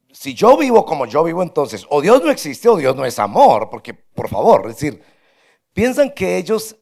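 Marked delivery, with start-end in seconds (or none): 0.1-3.7 louder, higher indignant retort; bitter objection. Retold or imitated. Doubter objecting to the pastor, louder indignant retort. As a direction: protest as one who feels abandoned. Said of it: "su primera reacción es".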